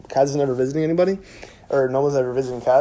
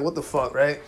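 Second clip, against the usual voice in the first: ordinary talk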